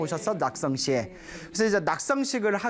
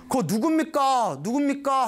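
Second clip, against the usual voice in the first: calling out; 6 semitones higher than usual. Acted. Calling out to stranger on hill.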